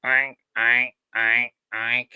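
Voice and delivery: mock pig oinks thrown to the piggy bank; half-hearted oinking for the pig; lousy ventriloquist oinks. Bad ventriloquist trying to make the piggy bank talk, mock oinks. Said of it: "trying to throw my voice to the pig".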